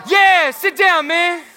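loud, slow cocky taunt; mock taunt. [name] taunting Ethan in a pretend basketball game, loud and slow. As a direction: rub it in on the defender.

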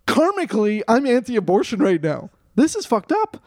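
high-pitched retold outrage; indignant protest. Student confronting fundraiser after donating, indignant protest. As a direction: protest in rising dismay at being implicated.

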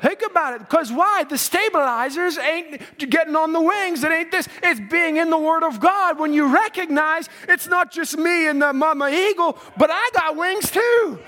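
building from high to a shouted climax; full-force preaching. Acted. Preacher telling believers they have wings, shouting preaching.

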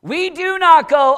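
normal talking voice

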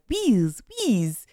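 wheels turned into "Wheeze" in a put-on foreign accent; imitated foreign accent. Showing co-host what crew did abroad, mock foreign accent on "Wheeze". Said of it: "those people would say the English words".

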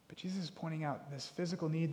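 normal talking voice

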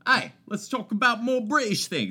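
high, loud mock-British; hammed-up British chat-show opener; bad British accent. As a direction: pitch it up in a silly British voice. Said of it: "where we can talk in British accents".

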